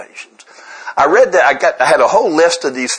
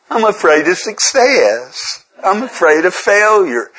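whiny, slow, higher pitched; 5 semitones higher, slower than usual. Retold or imitated. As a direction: whine it out like a complaint. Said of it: "read it with a whiny voice".

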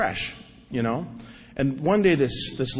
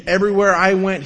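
ordinary delivery

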